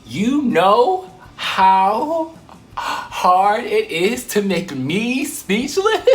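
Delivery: very slow, high and loud incredulous question; drawn-out disbelief. Real, not acted. Telling viewers how the creep left her speechless, dragged out high. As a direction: stretch every word out in disbelief. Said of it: "I had no response".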